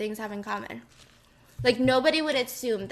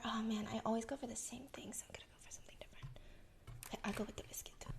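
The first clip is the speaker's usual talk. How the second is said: whispering; 4 semitones lower, 22 dB quieter, much faster than usual. Real, not acted. ASMR mukbang whispering.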